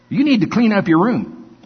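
mock-stern order to a grandchild; stern grandparent. Grandfather acting out a scolding.

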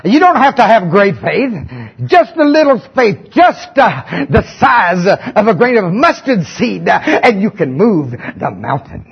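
hammed-up southern revival preacher; southern preaching voice. Shown as a demonstration. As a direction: preach it like a fired-up southern pastor. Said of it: "I gotta get my southern preaching voice on".

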